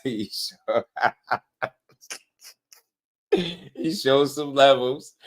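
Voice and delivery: words squeezed out through giggles; laughing. Real, not acted. Host gloats about the fighter's win, laughing.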